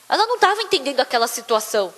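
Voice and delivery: ordinary delivery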